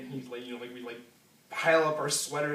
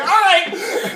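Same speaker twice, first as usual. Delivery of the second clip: laughing; 14 dB louder than usual. Retold or imitated. Agreeing while fleeing, laughing.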